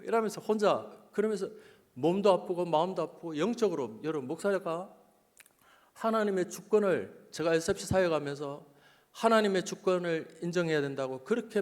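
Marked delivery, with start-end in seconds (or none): none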